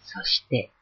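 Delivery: ordinary delivery, nothing marked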